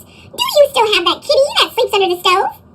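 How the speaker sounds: high, crackly little troll voice; little troll calling to Kyrie, high and crackly. Acted. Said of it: "in a high, crackly voice".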